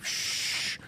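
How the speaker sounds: a soft breathy shush with no voice; mock soothing shush. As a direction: hush them gently to sleep. Shushing someone to sleep.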